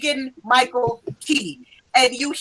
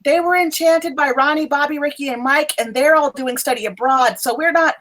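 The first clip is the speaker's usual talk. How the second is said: higher-pitched, earnest professor voice; brief the students in a raised pitch; professor figure, urgent; 6 semitones higher than usual. Acted. Professor asking upperclassmen for help with enchantments, urgent higher voice.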